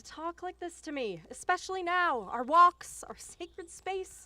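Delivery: pained reproach. Acted. Mother rebukes daughter.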